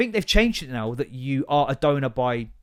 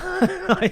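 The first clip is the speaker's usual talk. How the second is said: high laugh; 5 dB louder than usual. Real, not acted. Laughs at own joke.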